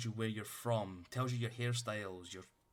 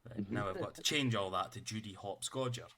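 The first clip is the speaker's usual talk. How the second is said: ordinary delivery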